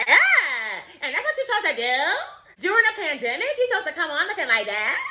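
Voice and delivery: high, squealed mock scolding; mock outraged commenters. Imitating commenters judging her pandemic look, high-pitched mock outrage. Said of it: "They'll be like".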